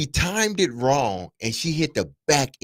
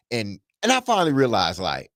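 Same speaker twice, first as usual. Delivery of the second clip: normal talking voice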